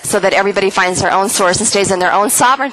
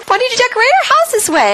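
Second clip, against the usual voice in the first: much higher, fast bewildered question; confused coma patient; faster than usual. Retold or imitated. Patient mistaking hospital room for home, bewildered. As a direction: sound lost and baffled. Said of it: "And I'm like".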